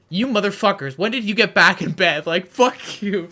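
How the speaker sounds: half-hammed fury at being woken; angry outburst. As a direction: woken roommate cursing the friends, mock angry yell. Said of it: "Giant wakes up and goes".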